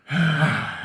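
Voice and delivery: long theatrical exhale; dramatic sigh. Pretend. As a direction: heave a big showy sigh.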